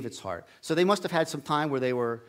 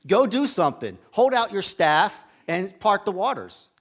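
louder, higher scolding shout; scolding God; 4 semitones higher, 6 dB louder than usual. Retold or imitated. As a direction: scold him for standing idle. God commanding Moses.

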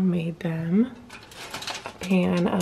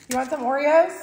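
teasing offer; 8 semitones higher, 5 dB louder than usual. Acted. Repeats prank offer.

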